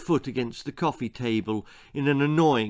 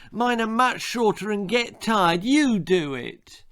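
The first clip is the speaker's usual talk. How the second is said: put-on whining child; 10 semitones higher, 3 dB louder than usual. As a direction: whine it like a sulky five-year-old. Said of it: "whined Jack".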